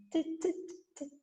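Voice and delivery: sharp, quiet tongue taps of t; crisp t clicks. Shown as a demonstration. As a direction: tap out the t sound crisply. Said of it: "hace este sonido". (golpecitos secos de t)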